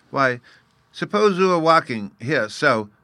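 put-on high, loud childlike explaining; little fairy boy, amused. Bruno amused at the grown-up's ignorance, patiently demonstrating. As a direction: explain it in a small child's piping voice.

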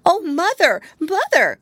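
high, loud frightened kitten cry; a scared little kitten calling for help. A narrator's reading. Terrified kitten runs crying to her mother.

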